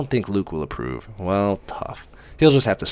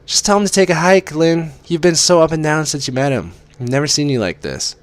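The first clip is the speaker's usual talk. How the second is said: higher, louder, outraged friend; indignant friend; 10 semitones higher, 6 dB louder than usual. Acted. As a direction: tell her friend to dump him. Kate's indignant voice.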